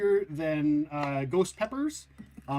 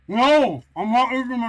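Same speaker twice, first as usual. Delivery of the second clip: loud; 4 semitones higher, 7 dB louder than usual. Real, not acted. Sudden loud reaction.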